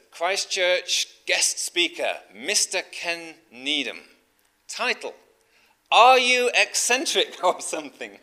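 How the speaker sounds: loud, high, slow proclamation; banner announcement. Acted. Preacher reads out the banner over the street, mock-grand announcement. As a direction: announce each part grandly, building to the punchline.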